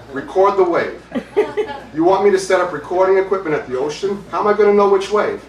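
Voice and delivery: loud, high-pitched Brooklyn incredulity; incredulous. Acted. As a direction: protest an absurd request. Incredulous studio man in retold argument.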